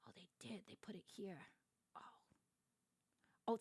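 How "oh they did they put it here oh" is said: soft unvoiced whisper. Real, not acted. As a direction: whisper it to yourself. Whispering to herself.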